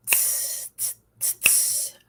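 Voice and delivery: soft tss hi-hat sound, unvoiced; cymbal hiss. Shown as a demonstration. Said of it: "Your turn".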